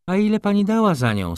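high, loud, quick demand; baroness hissing. A narrator's reading. Baroness questions Stawska over the price, hissing. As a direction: fire it sharply, pitched right up. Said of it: "syknęła baronowa".

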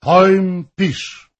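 high, loud command; shouted order. A narrator's reading. Guests press Hoym to write, shouting.

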